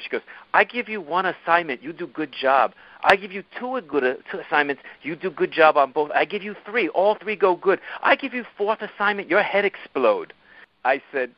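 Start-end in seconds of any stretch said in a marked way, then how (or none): 0.5-10.3 imitated Korean accent, pitched higher, slowing at the end; Damiana's Korean accent. Korean boss listing assignments to Milo, higher imitated Korean accent. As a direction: mimic a Korean boss, higher voice, explaining step by step. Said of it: "impersonate her without doing her accent".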